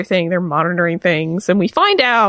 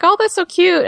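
gushing wife; 11 semitones higher than usual. Retold or imitated. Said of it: "And Maurice is like".

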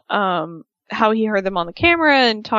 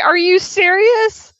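higher, slow disbelief; mock disbelief; 10 semitones higher, 5 dB louder, slower than usual. Wife opening a disappointing gift, incredulous mimic. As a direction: stretch it out in mock outrage. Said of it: "she's like".

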